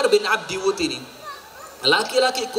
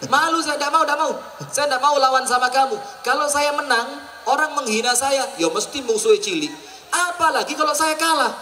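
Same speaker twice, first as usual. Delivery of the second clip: higher-pitched, mildly exasperated acted protest; embarrassed refusal; 6 semitones higher than usual. Warrior refuses to fight his friend's young son, mock embarrassed protest.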